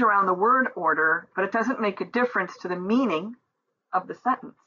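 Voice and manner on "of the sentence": ordinary talk, nothing marked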